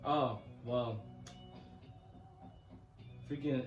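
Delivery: ordinary delivery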